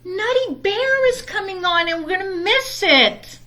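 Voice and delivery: high, pleading little bear; pleading cub. Acted. Brother begs Mama for his show, high and pleading. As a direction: beg not to miss the show. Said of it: "said Brother".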